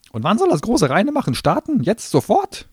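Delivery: high, loud sneering gnome voice; a cornered little demon spitting scorn; shrill gnome tirade. Acted. Gnome asking.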